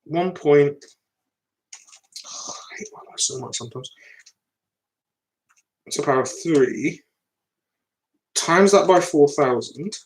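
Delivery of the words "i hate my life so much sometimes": weary whispered sigh of frustration. Real, not acted. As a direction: breathe it out in a defeated whisper. Frustrated aside while solving.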